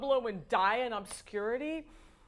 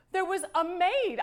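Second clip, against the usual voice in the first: amazed; 9 semitones higher, 5 dB louder than usual. Real, not acted. Amazed at a maid.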